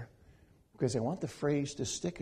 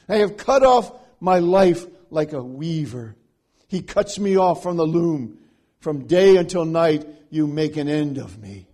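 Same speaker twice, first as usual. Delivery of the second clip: loud, emphatic reading of the lament; forceful public scripture reading; dramatic scripture reading; 12 dB louder than usual. Acted. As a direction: declaim the psalm like a sermon text. Reads Hezekiah's lament aloud.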